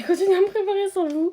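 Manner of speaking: high, loud excited chatter; bubbly high voice. Real, not acted.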